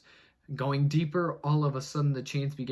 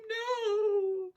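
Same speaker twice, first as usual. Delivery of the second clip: ghostly no; 4 dB louder than usual. Acted. Ghost refuses, wailing.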